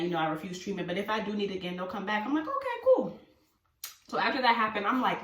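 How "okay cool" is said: high, chirpy okay; eager agreement. Retold or imitated. Happily accepting the paramedics' release, eager agreement. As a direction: agree brightly, pitched way up.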